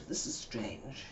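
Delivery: soft, slowed thought to herself; slow hushed thought; quiet inner thought. Retold or imitated. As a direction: hush it as if thinking aloud.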